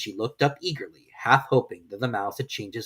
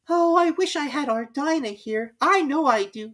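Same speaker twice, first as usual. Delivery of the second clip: put-on higher, slow wistful girl; wistful Alice; 4 dB louder than usual. Alice wishing aloud to nobody, higher. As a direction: wish aloud.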